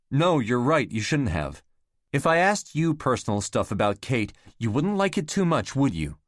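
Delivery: heated quarrel, voice up. Acted. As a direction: fire it back at her, hurt. Raised our voices at each other.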